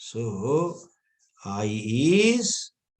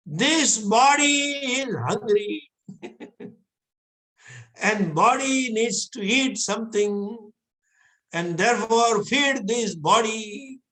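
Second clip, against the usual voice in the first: higher, mock-solemn spiritual voice; hammed-up mystical pronouncement, pitched higher; changed lofty tone; 6 semitones higher than usual. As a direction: put on a lofty holy-man tone, half laughing. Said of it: "Need not change the tone also".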